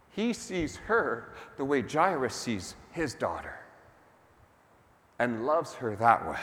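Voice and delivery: normal talking voice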